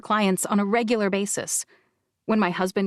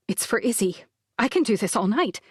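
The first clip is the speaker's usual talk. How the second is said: plain reading voice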